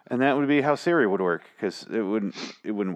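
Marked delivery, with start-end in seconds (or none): none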